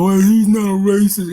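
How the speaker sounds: slow, loud mocking imitation. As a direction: parrot the excuse with scorn. Host mocks caller's expected answer.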